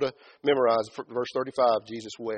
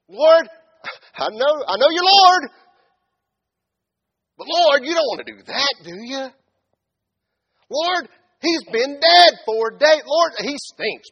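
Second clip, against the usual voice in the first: exasperated protest; 11 semitones higher, 11 dB louder than usual. Acted. Preacher acting out people correcting God, loud exasperated protest. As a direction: argue back in hammed-up dismay. Acts believer arguing with Lord.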